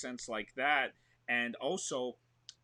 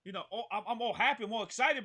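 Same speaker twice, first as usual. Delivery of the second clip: ordinary talk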